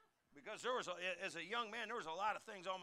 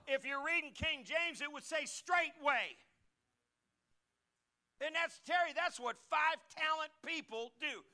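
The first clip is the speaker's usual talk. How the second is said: higher, louder, fervent pulpit voice; preaching at full force; 7 semitones higher, 7 dB louder than usual. Acted. Pastor praising five talent people to his congregation, fervent. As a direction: drive the point home to the church.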